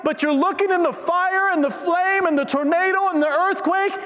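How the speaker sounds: high, sustained impassioned cry; full-force preaching. Real, not acted. Preacher urging listeners who seek God in the earthquake, fervent proclamation. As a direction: preach it at the top of your range.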